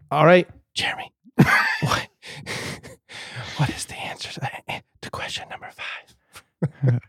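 name called, then sinking into a halting whisper; teacher's ASMR whisper. Acted. Teacher asking Jeremy question five, ASMR whisper. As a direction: whisper a classroom question, giggling partway. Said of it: "teacher's like".

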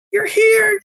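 high, squeaky "you're here"; mock surprise. To his buddy's fiance walking into the pub, mock surprised. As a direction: feign delight as she walks in.

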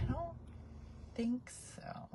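tiny, breathy hushed reply; sheepish whisper. Retold or imitated. Brooke admitting the flood, hushed and sheepish. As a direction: answer small and guilty.

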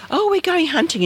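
high-pitched imitation of mother announcing plans; retold eager announcement; mother's voice. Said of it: "my mother would say".

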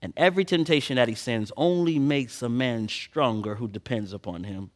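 ordinary delivery

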